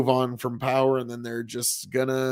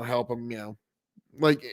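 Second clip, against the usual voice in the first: normal talking voice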